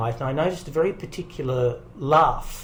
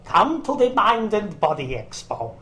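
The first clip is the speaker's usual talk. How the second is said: Yoel and Lim impersonation; 6 semitones higher than usual. Pretend. Actor closing his radio ad demo, slow accented monk impersonation. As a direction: intone the ad slogan like a put-on Zen monk. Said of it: "I did my best Yoel and Lim impersonation".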